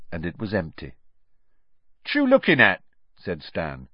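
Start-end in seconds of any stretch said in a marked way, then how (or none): 2.0-2.8 high, loud cheeky youth; nosy young bus conductor; young Cockney conductor. Acted. Stan voiced in story.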